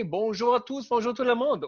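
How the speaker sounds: lightly French-voiced bonjour; French greeting. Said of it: "Or should I say".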